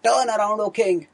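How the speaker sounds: rally the king with fury; loud defiant rallying call; angry battle cry. Retold or imitated. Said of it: "Ashwatthama was filled with anger".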